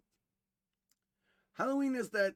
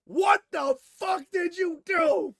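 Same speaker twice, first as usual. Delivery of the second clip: much higher, louder, slowed furious yell; furious mother; 10 semitones higher, 9 dB louder, slower than usual. Retold or imitated. Mother grabbing her son after the scare, furious yell. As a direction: tear into him, outraged parent. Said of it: "and goes".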